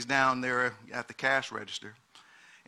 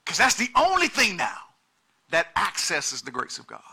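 preaching at full force; 3 semitones higher, 6 dB louder than usual. Acted. Pastor pressing the congregation on currency, full-force preaching.

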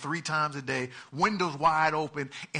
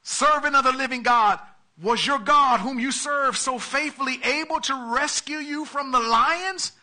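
higher, louder cry into the den; anguished call; 9 semitones higher, 6 dB louder than usual. A narrator's reading. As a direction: call out into the pit, desperate. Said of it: "he called out in anguish".

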